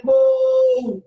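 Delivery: বউ called out long and loud, fondly; affectionate call. Shown as a demonstration. Husband fondly calling his wife, a long, affectionate call. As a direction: draw the vowel of বউ right out, lovingly. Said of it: "এখন বউরে যদি আপনি এখন আদর করে ডাকেন".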